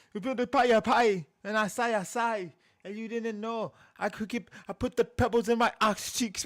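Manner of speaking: high, loud rapper imitation; mock UK rapper. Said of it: "What he said".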